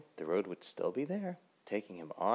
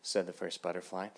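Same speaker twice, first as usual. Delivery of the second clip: ordinary delivery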